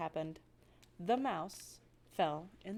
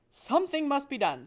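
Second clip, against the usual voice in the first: agitated father; 5 semitones higher, 7 dB louder than usual. Acted.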